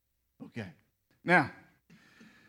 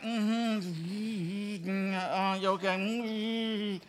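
distracted husband; 3 semitones lower, 7 dB quieter, much slower than usual. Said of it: "I'm like".

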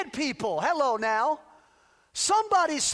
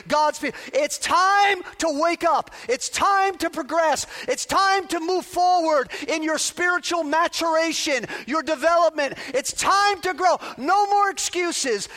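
loud, rising exhortation; preaching at full force; 3 semitones higher, 5 dB louder than usual. Real, not acted. Pastor exhorting church for the new year, loud preaching. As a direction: press the congregation forward.

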